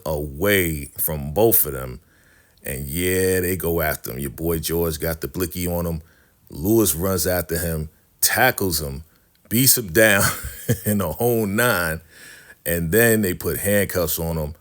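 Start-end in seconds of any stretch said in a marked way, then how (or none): none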